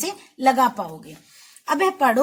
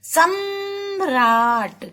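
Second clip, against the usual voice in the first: सम्राट sung out long and slow for the class; drawn-out demo; much slower than usual. Shown as a demonstration. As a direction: slowly draw out सम्राट so the र can be heard. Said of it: "आवाज से समझ में आ रहा है ना आपको".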